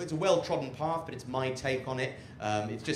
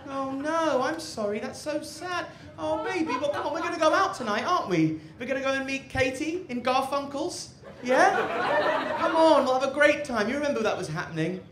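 much higher, sweet fussing voice; doting partner; 12 semitones higher than usual. Acted. The cook's partner greeting them home, high and doting. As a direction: coo over them like a loving partner. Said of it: "they get back home from a hard day".

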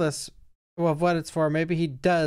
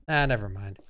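usual speaking voice

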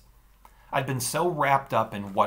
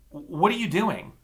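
high, louder alarmed question; 10 semitones higher, 6 dB louder than usual. Acted. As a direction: ask in sudden alarm. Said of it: "the other Daniel exclaimed".

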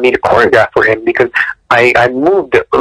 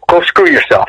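high, playful censored version of the insult; self-censoring for the show host, pitched up and playful; cleaned-up retort; 11 semitones higher than usual. Retold or imitated. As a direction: swap in the polite swear with a mock-prim lilt. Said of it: "Well, I do".

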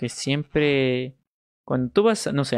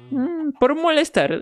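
much higher, drawn-out teasing squeak; playful squeak; 14 semitones higher, 4 dB louder than usual. Real, not acted. Streamer confesses to chat he says it to tease, squeaky and slow.

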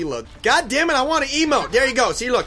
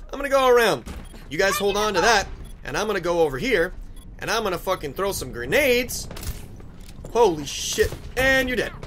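ordinary delivery

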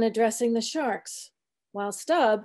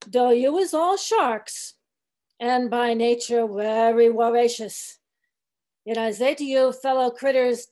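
put-on old cook preaching. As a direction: preach to the sharks grudgingly, dialect and all. Cook's sermon to the sharks.

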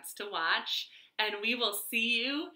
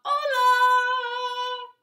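high, loud sing-song dog greeting; a happy hungry dog calling hello; 8 dB louder than usual. Acted. Dog calling up to the cat on the roof, sing-song. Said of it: "called out".

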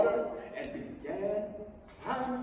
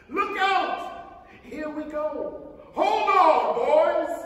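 high, very loud warning; excited yell; 8 semitones higher, 11 dB louder, much slower than usual. Acted. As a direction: shout to the crew to hold on. Peach rising, urgent warning.

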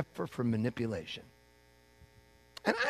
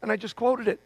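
higher, louder, fast and breathless with wonder; amazed retelling; 7 semitones higher, 4 dB louder than usual. Retold or imitated. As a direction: blurt it out like you can't believe it happened. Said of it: "walk away from the person and go".

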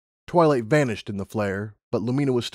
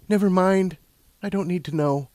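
higher-pitched brush-off; a lightly voiced, higher character voice; lightly voiced Lumina; 8 semitones higher than usual. A narrator's reading. Lumina declining to hear more, lightly voiced character.

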